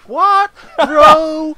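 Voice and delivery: high, loud "what?!" of a challenger; mock outraged disbelief. Student challenged to long division in the corridor, mock outraged. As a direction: play up the shock, pitched high and loud.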